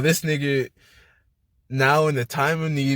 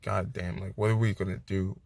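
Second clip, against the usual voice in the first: hushed dread; 7 semitones lower, 7 dB quieter than usual. Retold or imitated. Despair facing Thanos' army.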